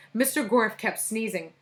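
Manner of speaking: plain reading voice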